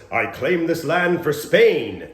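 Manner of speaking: loud, high, slow proclamation; improv explorer's proclamation. Acted. Improviser making an opening offer, loud proclamation. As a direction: proclaim it like a stage conqueror. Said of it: "somebody that says".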